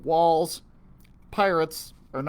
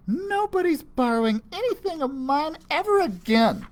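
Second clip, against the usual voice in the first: put-on indignant girl; 6 dB louder than usual. Enna refusing, guarding necklace.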